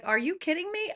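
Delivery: sharp high-pitched disbelief; mock outrage at the idea; incredulous. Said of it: "I'm like".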